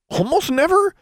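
high, incredulous rising exclamation; surprised exclamation. A narrator's reading. Alexandra reacting to the elf's claim, shocked high question. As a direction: leap up in disbelief on never. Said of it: "she exclaimed".

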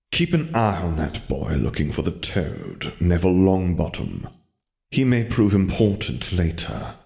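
put-on dark mentor warning the girl about the boy, slow; sinister mentor. Wizard Lenin voiced.

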